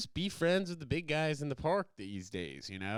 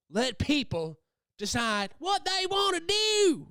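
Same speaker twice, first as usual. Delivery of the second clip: high, slow, loud proclamation; mock rallying cry; 6 dB louder, slower than usual. Vlogger belting a rallying cry to viewers, loud and high. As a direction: belt it out like a protest slogan.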